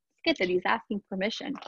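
usual speaking voice

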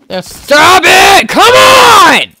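very high, loud, drawn-out yell; frantic scream. Real, not acted. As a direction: scream at your attackers in panic. Attacked by zombies.

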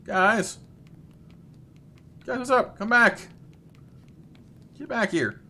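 usual speaking voice

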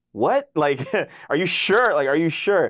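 high, loud, disbelieving "are you sure"; half-laughing double take; startled director. Retold or imitated. The director reacting to his request for another scream, in loud disbelief.

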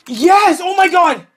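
high, loud victory shouts, slowly coming down; real jubilant screaming celebration; ecstatic shouting. Real, not acted. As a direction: yell in disbelief at a big win. Big win on case opening.

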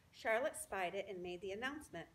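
ordinary delivery

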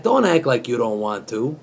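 normal talking voice

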